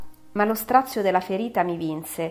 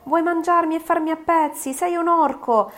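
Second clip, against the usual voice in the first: put-on child's voice, much higher and louder, screaming insults; a terrified boy hurling insults at the monster; frightened child; 10 semitones higher, 6 dB louder than usual. (bambino spaventato che grida insulti, voce molto acuta)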